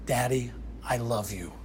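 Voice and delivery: put-on soft whispered child's words; daughter's tender whisper to her father. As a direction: breathe it out gently. Little daughter forgives him.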